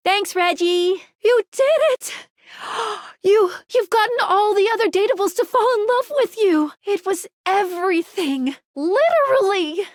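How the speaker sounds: high, gasping, gushing amazement; thrilled disbelief, pitched high; awed excitement. Acted. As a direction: gush breathlessly at their achievement. Overwhelmed at the player's success.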